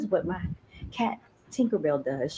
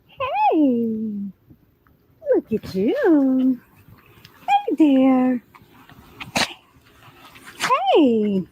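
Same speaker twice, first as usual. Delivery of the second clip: high, bright sing-song voice for the dog; 11 semitones higher, 9 dB louder, slower than usual. Real, not acted. High-pitched talk to the dog.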